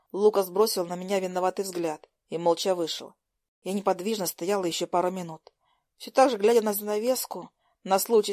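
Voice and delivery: ordinary delivery, nothing marked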